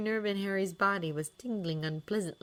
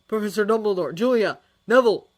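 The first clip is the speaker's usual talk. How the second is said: louder calling out names; shouting; 5 semitones higher, 13 dB louder, faster than usual. A narrator's reading. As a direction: call out into the rubble. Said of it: "he shouted".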